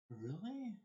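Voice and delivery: high, rising-then-falling "really?"; retold surprise. Told by aunt it is British, acted surprise. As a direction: act out astonishment with a big pitch swoop.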